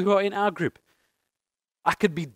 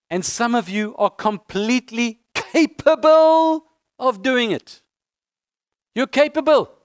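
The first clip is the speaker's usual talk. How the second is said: higher and louder, building conviction; rousing exhortation; 6 semitones higher, 5 dB louder, slower than usual. Real, not acted. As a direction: fire them up with belief. Pastor exhorting congregation.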